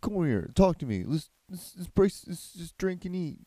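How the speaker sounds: high, hammed-up imitation of Jesus; mock Jesus calling someone over, high and over the top. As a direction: play a breezy high-voiced Jesus, mocking. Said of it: "Jesus said, like".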